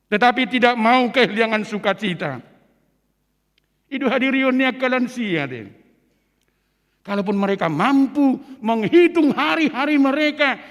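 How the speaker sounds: ordinary delivery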